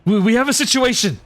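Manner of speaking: urgent messenger. Acted. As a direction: rush in with urgent news. Running messenger with urgent news.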